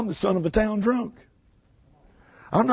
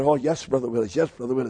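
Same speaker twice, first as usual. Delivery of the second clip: much lower, softer, meek voice; humble pastor's reply; 8 semitones lower, 5 dB quieter than usual. Retold or imitated. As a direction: play the modest old pastor answering humbly. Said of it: "He said".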